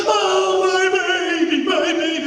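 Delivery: high, sung-out mock wail; crying mother. Mom at the door mourning her child, acted wail. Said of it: "and she's crying".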